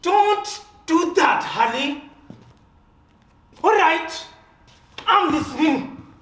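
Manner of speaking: loud and high, firm with anger; angry confrontation. Acted. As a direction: hold your ground, loud and hard. Sam confronts Harold, stung.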